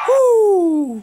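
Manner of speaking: loud high cheer; excited whoop. Real, not acted. Cheering in game.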